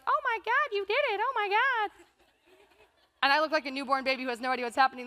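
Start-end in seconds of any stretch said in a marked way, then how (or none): 0.1-1.9 much higher, gushing excited squeal; siblings' excitement. Retold or imitated. Siblings rushing down the stands, squealing with joy. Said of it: "they're like".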